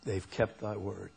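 soft, low repeat of the verse; quiet reflection. Shown as a demonstration. Preacher repeats the verse for congregation to absorb, quietly reflective. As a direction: let it settle, hushed and understated.

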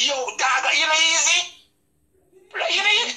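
high, swooping imitation of the man; mocking man's greeting. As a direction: squeak it up high like a surprised friend. Man at bar greets him.

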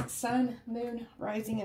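ordinary talk, nothing marked

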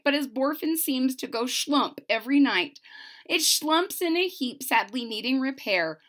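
lively storybook read-aloud; bouncy picture-book reading voice; reading to children. Acted. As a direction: bring the rhymes to life for little listeners.

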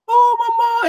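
high, loud mock cry of a child. Child crying out to her mother after touching the hot pot. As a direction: wail like a little girl who burned her hand. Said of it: "and she cries".

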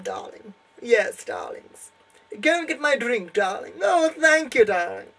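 a lightly voiced grande dame fishing for compliments; posh lady. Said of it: "Oh, I'm extremely posh".

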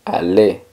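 Aller said "ah-LAY"; silent final R. Shown as a demonstration. Teacher models the verb "to go", Aller with silent R. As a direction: end Aller on the E, no R. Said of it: "la R al final no suena".

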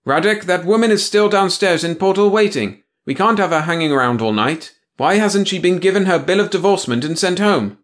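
loud barked name; harsh boss bark. Acted. Hard boss demands.

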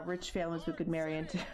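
usual speaking voice

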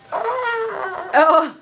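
shrill squawk, very high; turkey squawk. Retold or imitated. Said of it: "make a turkey sound".

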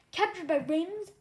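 mock the danger as absurd; eye-rolling. A narrator's reading. Young dragon mocking the elder's fear, scoffing. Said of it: "I rolled my eyes".

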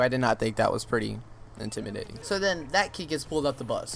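ordinary talk

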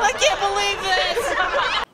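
high, loud, slow disbelieving squeal; shrieking outrage. Acted. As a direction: wail it in disbelief.

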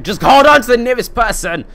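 very loud, high panicked-style yell; mock bus announcement yell. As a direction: yell a parody warning at the whole bus. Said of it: "We get".

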